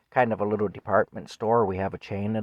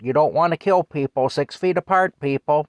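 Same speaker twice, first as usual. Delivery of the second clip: loud, higher-pitched mock public nagging; insistent nagging; 6 semitones higher, 8 dB louder than usual. Man mimicking pandemic messages hammered at the public, loud nagging. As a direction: chant the orders loudly, over and over.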